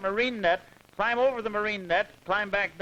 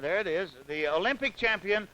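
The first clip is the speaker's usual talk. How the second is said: ordinary delivery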